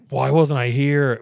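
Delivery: inner panic. Retold or imitated. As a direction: ask it in dread, drawn out. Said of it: "in my brain, I was like".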